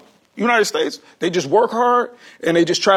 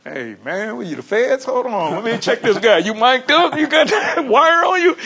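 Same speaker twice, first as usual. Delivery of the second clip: playful rising accusation, laughing at the end; joking the interviewer is wearing a wire; mock suspicion; 5 semitones higher than usual. Jokingly suspects interviewer is a fed.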